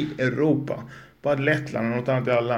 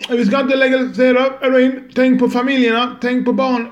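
much higher, louder whiny mock voice; mocking a prohibitionist; 10 semitones higher, 7 dB louder than usual. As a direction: parody the hysterical opponent, pitched way up. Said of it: "Jag pratar till dig som". (hånfullt gnällig röst, mycket ljusare och högre)

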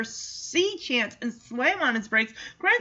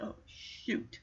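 whisper; 14 dB quieter than usual. Retold or imitated. Dismayed reaction to the dog news.

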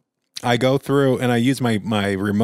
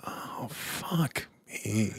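much softer, slow, defeated mutter; muttered dread; 13 dB quieter, much slower than usual. Retold or imitated. Stuck with the clingy stranger, soft slow defeated mutter. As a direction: grumble it under your breath. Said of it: "I'm like".

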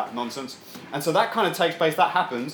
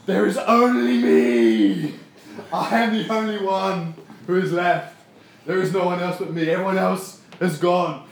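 whiny Elijah; 3 semitones higher, 6 dB louder than usual. Retold or imitated. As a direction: wail it like a sulking prophet. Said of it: "and he's like".

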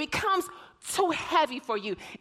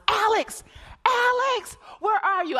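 frantic shout, much higher and louder; calling out; 10 semitones higher, 6 dB louder than usual. Retold or imitated. Mother reenacting shouting for her lost son. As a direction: call for a lost child across the mall. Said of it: "I began to call out for Alex".

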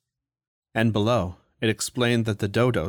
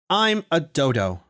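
higher, slow mock scream; 6 semitones higher, slower than usual. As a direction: proclaim it slowly at everyone. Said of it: "he screamed at everyone".

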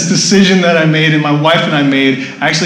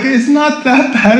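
imitated reassurance; 9 semitones higher, slower than usual. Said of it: "This guy told me, like".

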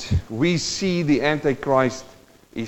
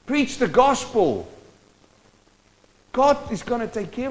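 high, impassioned pulpit exhortation; fervent preaching; 10 semitones higher than usual. Acted. Preacher urging the congregation to leave the phonies to God, impassioned preaching. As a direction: plead and urge the flock, voice lifted high.

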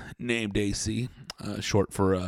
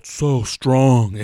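mock macho voice; 5 semitones higher, 8 dB louder than usual. Quoting the mic guy.

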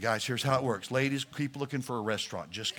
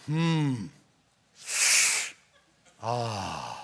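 sympathetic hum; 4 semitones lower than usual. Shown as a demonstration. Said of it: "Say it with me, guys".

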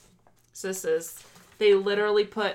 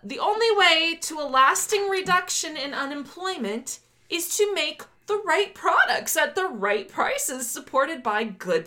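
high, slow oratorical read-out; declaimed manifesto reading; 7 semitones higher than usual. Acted. Host resumes reading manifesto to co-host, slow and declaimed. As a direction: proclaim each phrase deliberately, pitched up.

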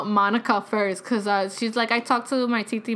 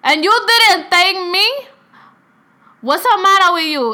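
loud, high imitated outrage; indignant mock scolding, pitched up; abuela scolding; 8 semitones higher, 8 dB louder than usual. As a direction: tell off a grandchild as an offended grandmother.

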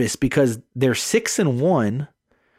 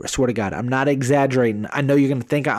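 normal talking voice

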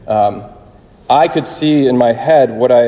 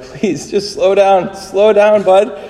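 high, slow, pleading through laughter; a dad begging his son to slow down while cracking up; laughing plea; 8 semitones higher, slower than usual. Retold or imitated. Dad laughing, begging his spilling son to slow down, high and slow. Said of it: "he's laughing so hard that he can't even hardly get it out".

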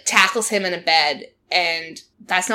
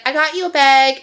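lightly put-on Southern accent, higher and louder; imitated Southern accent, pitched up; Southern accent impression; 6 semitones higher, 5 dB louder than usual. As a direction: play the eager Southern gift-giver. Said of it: "in her, like, South accent".

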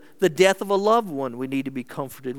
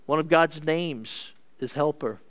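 normal talking voice

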